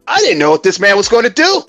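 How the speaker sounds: normal talking voice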